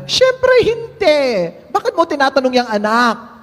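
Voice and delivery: hammed-up mother's high voice. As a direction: play the mom in a high put-on voice. Mother voiced in joke punchline.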